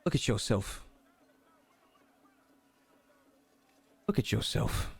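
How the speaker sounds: low Cockney voice, softly delivered; Cockney British accent. Acted. Deity speaks to the player from the mirror in a Cockney accent. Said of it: "kind of Cockney British accent".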